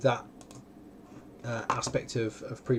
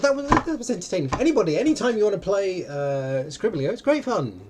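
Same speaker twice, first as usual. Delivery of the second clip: excited; 7 semitones higher, 4 dB louder than usual. Real, not acted. Enthusing about the game.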